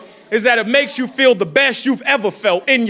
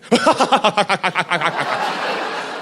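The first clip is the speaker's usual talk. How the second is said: put-on long rattling laugh; imitated cracking up; 4 semitones lower than usual. Comic imitates everybody in the room, long mock laughing fit. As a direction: mimic a roomful of people cracking up. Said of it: "starts cracking up laughing".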